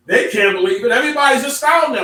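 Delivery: astounded. Acted. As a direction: raise it up with a laugh of disbelief. Preacher building excitement.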